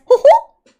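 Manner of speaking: high squeaky cartoon hoot; squeaky high Mickey-style hoot; Mickey Mouse hoo hoo.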